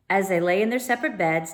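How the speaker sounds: usual speaking voice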